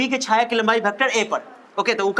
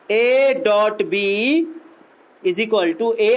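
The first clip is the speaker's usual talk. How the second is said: slow dictation; much slower than usual. Shown as a demonstration. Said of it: "dictating slowly to class".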